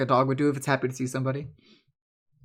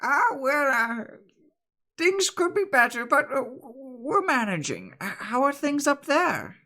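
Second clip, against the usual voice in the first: lightly voiced weary old woman, higher; 11 semitones higher than usual. Bread woman telling Riley they are managing, lightly voiced. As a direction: sigh it out like a tired granny. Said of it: "And she goes".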